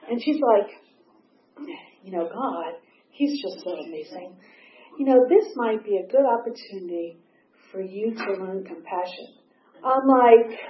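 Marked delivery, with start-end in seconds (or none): 2.0-9.3 much softer, serene mock-holy voice; serene sponsor. Sponsor Linda gently preaching God's plan, mock serene softness. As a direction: hush it like a saintly adviser.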